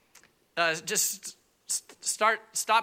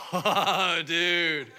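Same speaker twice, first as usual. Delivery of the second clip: surfer dude; 3 dB louder than usual. Retold or imitated. Surfer tech spots swollen battery.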